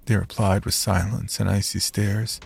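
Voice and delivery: ordinary delivery, nothing marked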